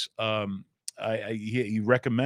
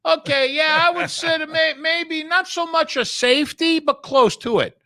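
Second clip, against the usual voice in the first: high, loud put-on Jimmy the Greek voice with a Brooklynese tinge; 7 dB louder than usual. Oddsmaker dodging rules on air, loud and high. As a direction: ham up the old oddsmaker dodging numbers. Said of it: "He says".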